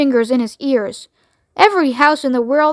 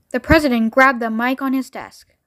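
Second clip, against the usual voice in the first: ordinary delivery, nothing marked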